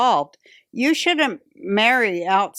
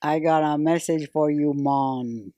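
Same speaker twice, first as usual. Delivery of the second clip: fake Jamaican accent; 6 semitones lower, 4 dB quieter than usual. Shown as a demonstration. Comic doing her fake Jamaican accent for her act bit. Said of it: "I tried to do the fake Jamaican accent".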